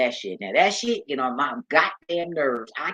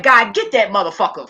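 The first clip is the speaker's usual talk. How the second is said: loud, high-pitched mock fury; retold mad outburst; 7 semitones higher, 11 dB louder than usual. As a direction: yell it out in exaggerated exasperation. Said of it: "I be mad at a".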